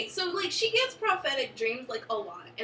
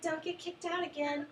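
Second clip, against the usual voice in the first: higher, softer imitated mom's parting reminder; passive-aggressive mom; 5 semitones higher, 7 dB quieter than usual. Fan imitating Joyce seeing her daughter off, passive-aggressive. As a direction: imitate a mom's quietly pointed send-off. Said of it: "So passive aggressive".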